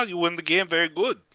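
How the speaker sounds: ordinary talk, nothing marked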